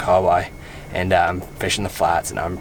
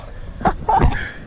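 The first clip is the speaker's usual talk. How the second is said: happy laugh. Real, not acted. Releasing the fish, happy.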